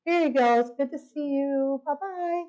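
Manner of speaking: high, sing-song farewell; sugary goodbye. Retold or imitated. Giving the desk lady her card before fleeing, sugary. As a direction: hand it over with exaggerated sweetness. Over-polite goodbye, then running off.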